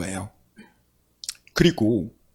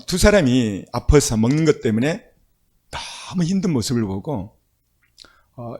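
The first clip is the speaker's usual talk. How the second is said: normal talking voice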